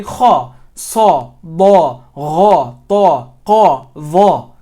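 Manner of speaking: kha, sa, da, gha, ta, qa, dha, each loud with a full ah; thick letters with fatha. Shown as a demonstration. Tutor modelling the correct way, thick and full. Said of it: "If you're not saying it that way".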